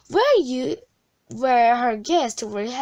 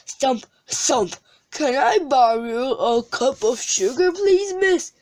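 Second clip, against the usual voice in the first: giant voice; 3 semitones higher than usual. A narrator's reading. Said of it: "said Jerry".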